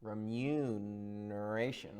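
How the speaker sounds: soft, slow and trailing up; slow, hushed wonder. Acted. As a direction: breathe the word like a treasure. Costard savouring the new word.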